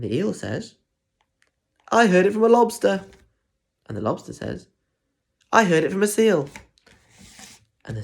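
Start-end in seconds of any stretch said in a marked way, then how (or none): 1.9-3.0 high, loud, quick lobster; lightly voiced lobster. Voicing the lobster. 5.5-6.5 higher, louder seal; lightly voiced seal. Voicing the seal.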